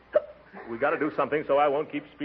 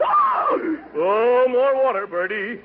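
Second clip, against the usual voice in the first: loud cry for water; 9 semitones higher, 5 dB louder, much slower than usual. Acted. Hiccup fit, calling for water.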